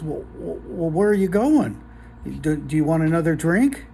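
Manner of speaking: stammering, rising nervous questions; nervous bartender. Acted. Bartender asking the huge man as he leaves, nervous stammer. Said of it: "he's kinda nervous now".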